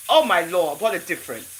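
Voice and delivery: usual speaking voice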